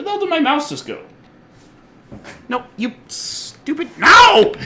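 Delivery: real exasperated yell at the computer; breaking into a high frustrated shout. Real, not acted. Yelling at his lost mouse.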